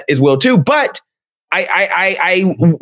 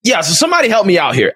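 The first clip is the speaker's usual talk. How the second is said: ordinary talk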